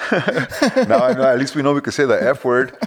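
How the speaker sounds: cracking up while saying it; laughing. Real, not acted. Laughing about being allowed to cuss.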